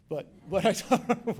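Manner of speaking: laughing. Real, not acted. Laughing mid-sentence.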